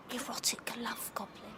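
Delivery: breathy seductive whisper; hushed come-on to her lover. Acted. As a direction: whisper it like a dirty secret. Seductive aside to Archie.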